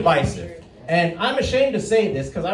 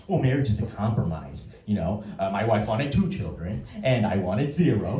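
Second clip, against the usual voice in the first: low, dry deadpan punchline; 8 semitones lower than usual. Retold or imitated. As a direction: deliver it flat and low to the nosy parent. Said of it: "I'll say".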